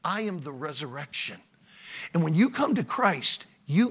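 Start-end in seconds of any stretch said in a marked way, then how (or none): 0.0-1.4 low, soft answer to an outburst; hushed, lowered voice of Jesus; calm reassurance. Acted. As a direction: bring it right down, quiet and steady. Jesus calmly answers upset Martha.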